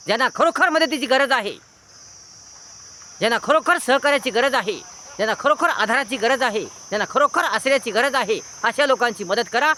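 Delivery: high, insistent sermon appeal; impassioned preaching. Real, not acted. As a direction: lift the pitch and press each need home. Preaches with raised voice.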